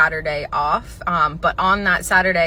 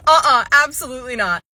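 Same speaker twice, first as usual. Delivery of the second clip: higher, louder, sassy "uh-uh"; sassy refusal; 5 semitones higher, 6 dB louder than usual. Retold or imitated. Manager hanging up on the rude woman, sassy and loud. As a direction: shut it down with full attitude.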